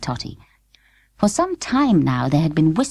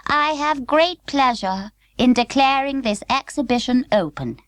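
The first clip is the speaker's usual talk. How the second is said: high, clear, distinct royal announcement; the Queen's clear voice; 9 semitones higher than usual. A narrator's reading. The Queen opens the exhibition, high and clear. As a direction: declare it like a queen. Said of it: "The Queen's voice was as clear".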